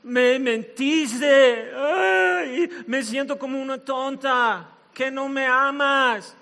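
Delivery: high, drawn-out wounded whine; hammed-up reproachful wail; whining woman. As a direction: complain like a hurt girlfriend, milking it. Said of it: "después ella le dice".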